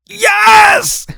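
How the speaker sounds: high, loud shouted outburst; desperate inner scream. Retold or imitated. Inwardly begging his friend to come, desperate shout. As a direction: shriek it out as a mock-desperate answer.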